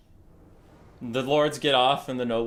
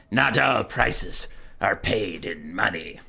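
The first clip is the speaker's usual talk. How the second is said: put-on mysterious old man; 7 semitones lower, slower than usual. Old man tempts the wanderer, low and hushed. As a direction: murmur it like a cryptic hermit. Old man's ominous hint.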